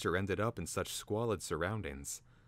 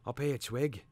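high, raspy, urgent creature call; 7 semitones higher than usual. A narrator's reading. Hidden bird calling Twig again, urgently. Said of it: "more urgently now".

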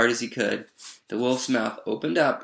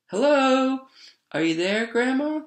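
high sing-song call, rising at the end; little girl calling out; 3 dB louder than usual. A narrator's reading. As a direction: call into the house, tentative. Girl calls into open house.

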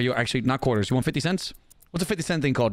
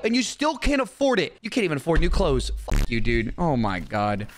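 ordinary talk, nothing marked

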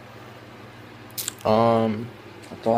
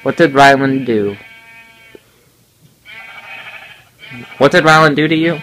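loud; 5 semitones higher, 9 dB louder than usual. Real, not acted. Pressing sister for answer.

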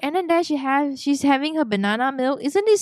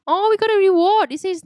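normal talking voice

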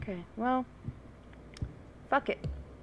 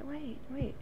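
ordinary talk, nothing marked

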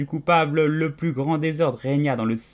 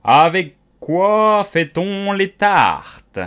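slow, loud put-on king voice; king questioning the cook, hammed up; cavernous voice; 5 semitones higher, 7 dB louder, much slower than usual. A narrator's reading. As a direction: draw it out with mock gravity. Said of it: "d'une voix caverneuse".

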